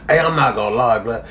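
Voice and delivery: ordinary talk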